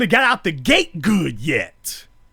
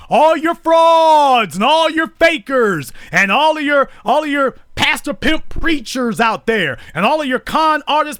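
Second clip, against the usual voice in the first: shouted tirade; 6 semitones higher than usual. Real, not acted. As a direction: hurl it at the fakers, full voice. Railing against fake leaders.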